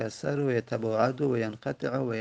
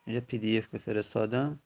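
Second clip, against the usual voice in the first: usual speaking voice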